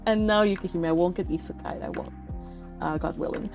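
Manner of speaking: ordinary talk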